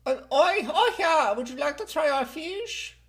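high, lilting Swedish impression; exaggerated high Swedish voice; Swedish accent. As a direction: pitch it up in a sing-song Swedish accent.

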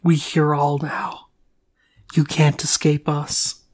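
slow, whispered demon voice; menacing demon whisper, drawn out slowly; creepy demon voice. Pretend. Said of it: "you sound like a demon there yourself".

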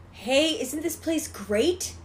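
high, drawn-out cheerful mom voice; singsong put-on enthusiasm, imitating mom; upbeat mom. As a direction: sell the place, slowly and high. Mom acted in retold scene.

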